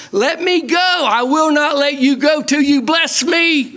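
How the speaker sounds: cling on and beg for the blessing; Jacob pleading. Acted. Jacob begging the angel not to let go, high and urgent.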